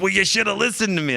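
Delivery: high-pitched imitated reprimand; mock scolding. Coworker scolding the rescued climber, high mock reproach. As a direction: tell them off in a put-on high voice.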